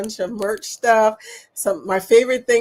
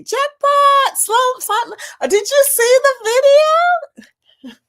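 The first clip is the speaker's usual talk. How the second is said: much higher, louder, slow excited call; 8 dB louder, slower than usual. Real, not acted. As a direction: gush about the jackpot. Excited about jackpot video.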